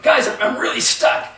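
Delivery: stuck man calling out, starting to panic; retold rising panic. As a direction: let the worry creep into it. Ryan stuck, getting frustrated.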